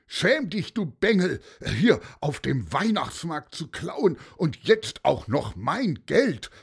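high, indignant telling-off; angry scolding shout. A narrator's reading. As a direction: tear into the boy as the robbed vendor. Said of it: "brüllte auch Klaus Clausen".

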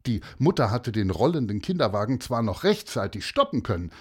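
ordinary delivery, nothing marked

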